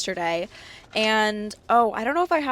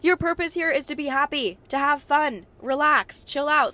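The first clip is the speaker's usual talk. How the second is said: higher-pitched uplifting coach; 6 semitones higher, 3 dB louder than usual. Retold or imitated. Coach telling listeners their purpose is to be happy, uplifting. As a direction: imitate a motivational speaker, pitched up and emphatic. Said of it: "She's like".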